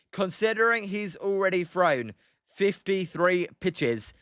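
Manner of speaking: ordinary talk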